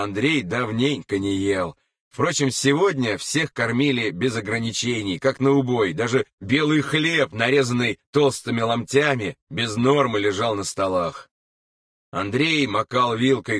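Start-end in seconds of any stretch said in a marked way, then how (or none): none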